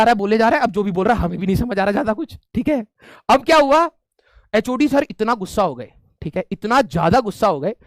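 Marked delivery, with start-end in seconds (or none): none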